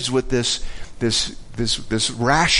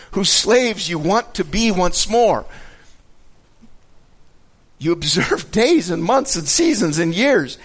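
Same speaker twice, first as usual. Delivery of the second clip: impassioned scripture reading; 4 semitones higher than usual. Acted. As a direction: read it as an earnest reproach. Reads scripture aloud.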